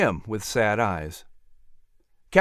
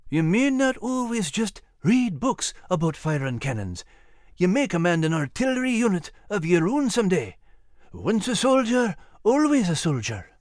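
higher Scottish voice, encouraging; knowing old Scottish sergeant; 6 semitones higher than usual. Acted. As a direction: confide in a lad with a knowing grin. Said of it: "leaning in with a knowing grin".

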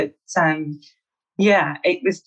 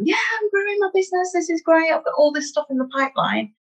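put-on much higher, bright and breezy quote of her old self; mock upbeat self; 11 semitones higher, faster than usual. Coach recalls her carefree pre-pandemic self, mock excited. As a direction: gush like everything's going great, then slightly faster.

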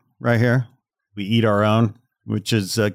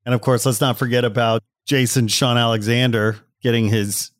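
normal talking voice

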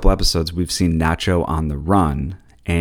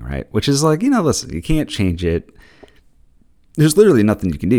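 ordinary talk

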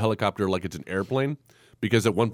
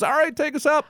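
pitched-up bossy order; high mock command. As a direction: squeak it out as a mock boss. Mocking movie villain ordering takeoff.

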